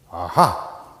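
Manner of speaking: ordinary talk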